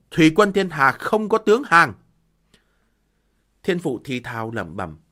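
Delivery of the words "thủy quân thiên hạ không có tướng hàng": higher, louder defiant cry. Acted. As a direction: cry out proudly. General repeats refusal again.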